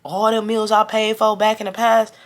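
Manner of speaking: high-pitched mimicry of a friend's attitude; getting an attitude. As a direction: mimic her indignant claim. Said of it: "getting an attitude, too".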